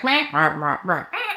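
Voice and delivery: brain going offline, mocked; a goofy meh meh imitation; mock brain babble. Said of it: "the logic's like".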